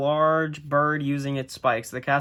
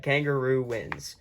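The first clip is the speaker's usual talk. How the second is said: ordinary delivery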